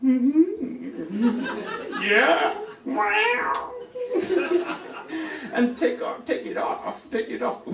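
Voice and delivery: high, hammed-up lustful giggling and cooing; mock-lecherous high voice with laughs; leering old women. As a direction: egg on a stripper like randy old ladies. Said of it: "all the old women are there looking at me like".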